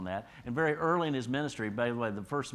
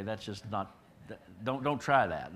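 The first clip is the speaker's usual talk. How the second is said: ordinary talk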